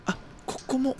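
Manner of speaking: hushed whisper to himself. Real, not acted. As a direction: breathe it almost silently. Unvoiced aside.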